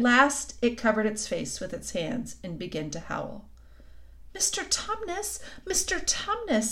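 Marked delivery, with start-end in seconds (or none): 4.3-6.7 high, anxious pleading call; great distress. A narrator's reading. Lucy to the weeping faun, in great distress. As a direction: plead in a small high child's voice. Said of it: "said Lucy in great distress".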